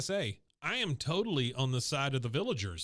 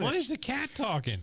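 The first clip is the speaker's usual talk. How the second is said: high, disbelieving question; can't believe what he's hearing; incredulous; 10 semitones higher than usual. Real, not acted. Asking his co-host in disbelief about the talking cat.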